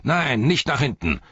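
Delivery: high, forceful slave-driver shout; bellowed order. A narrator's reading. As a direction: yell a correction down the line. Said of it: "brüllte der Sklaventreiber".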